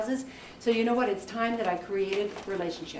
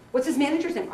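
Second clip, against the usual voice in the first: sharp raised grilling question, somewhat higher; Heidi drilling; 5 semitones higher, 4 dB louder, faster than usual. Retold or imitated. Mentor grilling a student about contacts, mock-stern drilling. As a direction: fire the question like a ruthless mentor. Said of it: "she was screaming".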